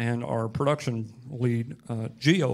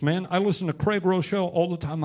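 usual speaking voice

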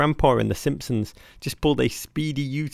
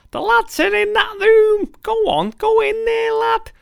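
very high, loud mock-secretary voice; secretary's voice; 6 dB louder than usual. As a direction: call out as a high-pitched club secretary. Voicing secretary waving them in.

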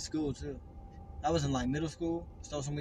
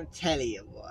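imitating friend; 4 semitones lower, 9 dB quieter than usual. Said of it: "He does it every time".

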